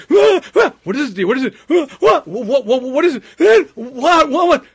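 high, loud hammed-up shrieks of fright; mock panicked shrieking. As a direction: shriek like a wife terrified of a bug. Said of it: "sees his wife on the chair screaming".

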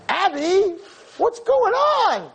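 high, slow astonished question; stunned to see her like this; surprised. Retold or imitated. Surprised greeting of old friend.

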